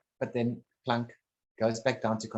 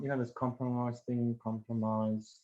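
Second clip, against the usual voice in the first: ordinary talk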